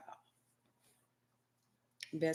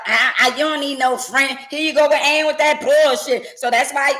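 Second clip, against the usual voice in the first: higher, louder jealous tirade; jealous rant; 6 semitones higher, 4 dB louder than usual. Acted. Jealous partner ranting about best friends, high loud tirade.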